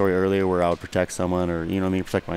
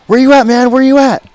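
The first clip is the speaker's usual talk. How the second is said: high, loud yell down the phone; imitated urgent shouting; 13 dB louder than usual. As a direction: mimic a worked-up friend calling from a party. Excited friend's phone call, acted out.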